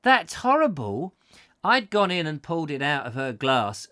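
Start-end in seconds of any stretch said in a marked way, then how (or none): 0.0-1.1 high disgusted squeal; disgusted girl. Retold or imitated. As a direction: recoil in disgust, higher. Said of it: "And she went".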